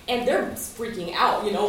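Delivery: normal talking voice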